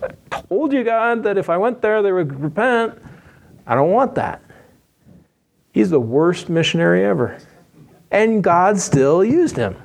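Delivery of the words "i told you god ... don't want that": high, whining complaint to God; whiny Jonah. Retold or imitated. Jonah complaining to God, high and whining. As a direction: whine like a sulking child. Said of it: "He's like".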